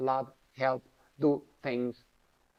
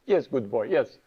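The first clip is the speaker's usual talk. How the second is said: firm guru affirmation; 3 semitones lower, 7 dB louder than usual. Retold or imitated. Guru affirms him firmly.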